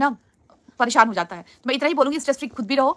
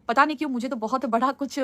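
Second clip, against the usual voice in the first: usual speaking voice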